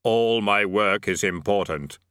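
slow, deep, measured Death. A narrator's reading. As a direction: intone it slowly as Death. Death speaks.

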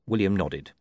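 ordinary delivery, nothing marked

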